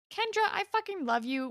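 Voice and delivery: high, quick appeal to a friend; mock exasperated plea. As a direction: plead with her in mock despair. Acted plea to a character.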